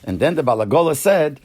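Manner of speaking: usual speaking voice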